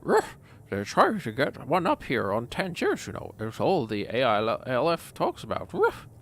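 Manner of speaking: exaggerated doggy sing-song; talking dog voice. Put-on voice between ruffs.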